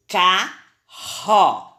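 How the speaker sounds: CARRO in two syllables, strong RR. Shown as a demonstration. Teacher confirming the sound for pupils, strong R in "Carro". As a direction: say "Carro" slowly, leaning on the strong R. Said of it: "esse som saiu forte".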